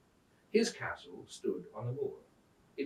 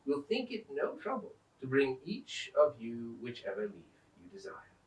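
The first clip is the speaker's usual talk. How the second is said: usual speaking voice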